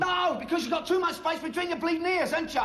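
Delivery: high, heated shouted insult; angry retort. Acted. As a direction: shout it back in a row. Insult in an escalating quarrel.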